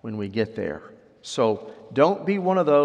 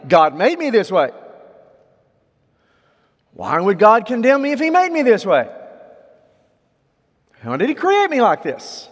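higher, louder indignant questioning; protesting objector; 10 semitones higher, 6 dB louder than usual. Retold or imitated. People in today's culture questioning how God made them, protesting. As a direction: push back in a raised, high voice. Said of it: "What do we hear today concerning sexuality?".

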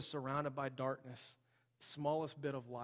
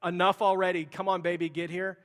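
put-on louder exasperated plea to the unborn baby; mock impatient outburst, fed up with waiting; hammed-up eager mother at month eight; impatient mom; 4 semitones higher, 11 dB louder than usual. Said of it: "You're like".

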